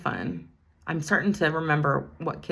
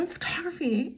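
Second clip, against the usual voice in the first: excited echo; 12 semitones higher than usual. Retold or imitated. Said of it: "and I said".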